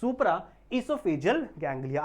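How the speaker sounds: supra esophageal ganglia stretched very slowly; teacher answering his own question slowly; slow dictation. Shown as a demonstration. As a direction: lay out the name slowly. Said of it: "उसका नाम क्या है?".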